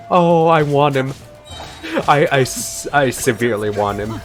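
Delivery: ordinary talk, nothing marked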